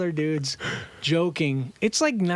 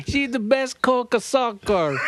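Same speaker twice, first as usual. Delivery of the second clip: mock Italian accent; 9 semitones higher, 4 dB louder than usual. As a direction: play a proud Italian winemaker, in an Italian accent. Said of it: "with this Italian accent".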